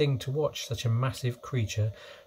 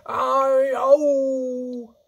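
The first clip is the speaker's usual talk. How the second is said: long, loud howl pitched high; roar; 13 semitones higher, 8 dB louder than usual. A narrator's reading. Sleeping giant thrashing in his nightmare, drawn-out roar. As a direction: howl out in terror. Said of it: "roared the flesh lump eater".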